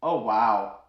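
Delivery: flat, bored "oh wow" without looking up; unimpressed deadpan. Retold or imitated. Colonel scrolling his phone brushing off the braggart, deadpan. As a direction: humour him without interest. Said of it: "does not even look up from his phone".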